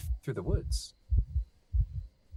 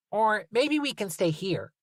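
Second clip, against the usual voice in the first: put-on child voice; 10 semitones higher than usual. Talia child voice.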